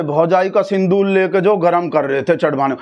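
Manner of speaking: usual speaking voice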